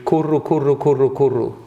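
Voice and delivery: repeating kurro kurro in a low murmur; babbling kurro. Retold or imitated. Preacher imitating his only tongue sound, kurro. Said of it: "Jag hade bara ett litet ljud".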